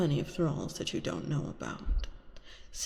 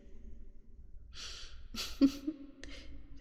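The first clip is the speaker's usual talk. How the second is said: hushed, breathy villain giggle; quiet evil laugh; 8 semitones higher, 6 dB quieter than usual. Acted. Vampire delighting in the entranced hunter, hushed evil laugh. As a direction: chuckle under the breath in triumph.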